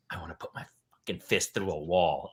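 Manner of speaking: low, soft whispered frustration; seething under breath. Retold or imitated. Coach confiding in other dads after losing, seething whisper. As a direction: whisper it, sick about the loss.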